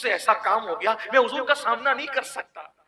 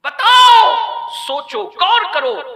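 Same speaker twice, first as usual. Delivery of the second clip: much higher, much louder call; slow booming call to reflect; shouted challenge; 13 semitones higher, 14 dB louder, slower than usual. Acted. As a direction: demand the crowd think. Preacher calling out to crowd.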